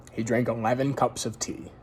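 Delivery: plain reading voice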